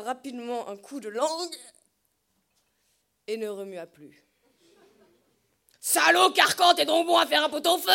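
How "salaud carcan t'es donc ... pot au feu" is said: insulte braillée fort, débit rapide; gueulé. Acted. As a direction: gueule ta colère contre la bête. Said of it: "gueulait de son côté l'oncle Antoine". (l'oncle Antoine gueule sur le taureau en le frappant)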